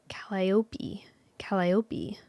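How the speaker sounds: whispered Calliope drills; whispering. Shown as a demonstration. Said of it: "I need [name] to".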